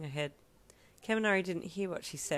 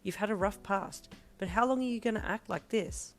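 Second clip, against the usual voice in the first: irked; 5 semitones higher than usual. A narrator's reading. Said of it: "starting to get a little irked".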